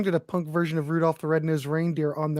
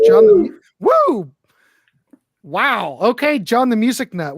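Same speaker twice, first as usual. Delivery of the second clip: excited announcer; 6 semitones higher, 6 dB louder than usual. Real, not acted. Host hypes next pick.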